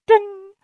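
high, sustained sung ding; imitated cabin chime. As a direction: mimic the plane's PA chime on one note.

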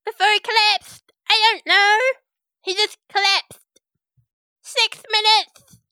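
high, loud sidekick; flustered little minion; high loud helper voice; [name]'s voice. Acted.

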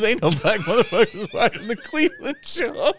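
laughing while quoting. Retold or imitated. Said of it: "He goes".

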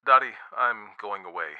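ordinary delivery

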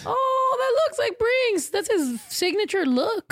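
high, slow cooing over the photo; cooing at a dog picture. Real, not acted. Host sees the sad dog photo and coos high and slow. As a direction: coo up high like at a puppy.

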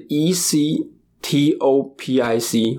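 slow letter-by-letter spelling. Shown as a demonstration. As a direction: spell each letter out slowly. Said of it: "这个怎么拼".

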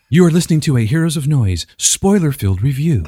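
put-on radio announcer read; show drop voice. Shown as a demonstration. Host demonstrating a drop for the caller, announcer voice. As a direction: announce it like a station ident. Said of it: "maybe we can get something like".

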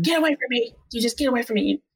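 high-pitched imitation of an annoyed patient; mock-irritated dismissal, voice pitched right up; imitated rebuff. Patient telling the doctor to leave him, imitated rebuff. Retold patient sending her away.

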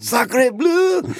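sacre bleu shouted up high, mock French; big mock-French sacre bleu; hammed-up French exclamation. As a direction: throw it up high like a cartoon Frenchman. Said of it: "That's right".

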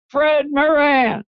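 high, drawn-out mock holler; imitated holler. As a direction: call the name out high and long, no real volume. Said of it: "somebody hollered out real loud".

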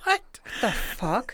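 slow stunned disbelief. Real, not acted. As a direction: let it hang in shock. Reacting to goose bashing.

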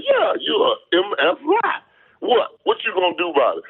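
high, challenging retold taunt; mock confrontation. Confronting the umpire over a lie, high and challenging. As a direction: dare him to do something.

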